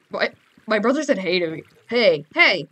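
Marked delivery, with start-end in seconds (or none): 1.9-2.7 loud, quick call. Real, not acted. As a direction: call out loudly to your brother. Calls out to brother.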